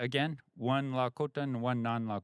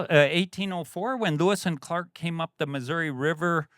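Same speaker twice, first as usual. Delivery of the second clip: ordinary delivery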